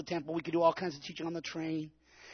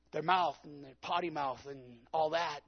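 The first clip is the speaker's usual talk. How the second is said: ordinary talk, nothing marked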